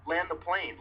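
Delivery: high, urgent yell; retold scream. Panicking passenger begging the crew to land, high shout. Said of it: "while screaming at the top of my lungs".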